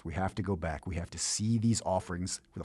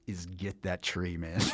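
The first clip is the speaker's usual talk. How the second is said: ordinary talk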